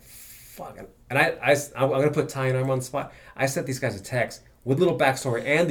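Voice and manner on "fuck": breathy whispered curse, moved. Retold or imitated. Telling friends how the clip hit him, whispered. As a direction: whisper it, choked up. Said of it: "I was like".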